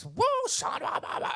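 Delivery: high, quick mock tongues babble; imitating speaking in tongues. Pastor suddenly prays aloud in tongues, high babbling imitation. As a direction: rattle off nonsense syllables like a fervent prayer. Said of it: "just started speaking in tongues".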